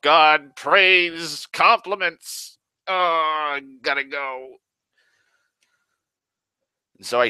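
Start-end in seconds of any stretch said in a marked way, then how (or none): 0.0-4.6 high, loud, drawn-out mock distress; hammed-up agony at being complimented, slow and high; awkward panic. Kid fleeing from praise at the diner, hammed-up awkward panic. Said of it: "awkwardly walked out 'cause I'm like".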